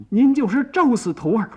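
又高又快地急切申辩; 忍着疼高声急求师傅; 忍痛恳求. A narrator's reading. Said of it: "忍着疼站起来了". (忍痛高声急诉)

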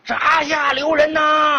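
opera shout. Acted. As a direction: stop the execution in a slow stage cry. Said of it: "嗓子都喊哑了".

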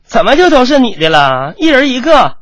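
high, pretend reproach; mock scolding. Mother pretending to blame her daughter-in-law, mock scolding. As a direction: scold in a high voice without meaning it. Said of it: "故意责怪说".

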